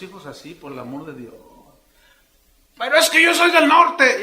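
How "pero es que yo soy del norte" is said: much higher, louder protest; loud excuse. Acted. Man defends his cursing to the preacher, loud. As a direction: fire back a defensive excuse.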